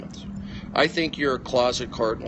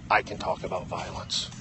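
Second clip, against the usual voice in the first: 1 low muttered echo; 8 semitones lower, 7 dB quieter than usual. Real, not acted. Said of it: "if they can talk about violence".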